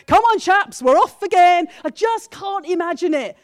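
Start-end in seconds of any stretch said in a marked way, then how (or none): 0.1-1.7 breezy cheer. Retold or imitated. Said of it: "I just can't see him going".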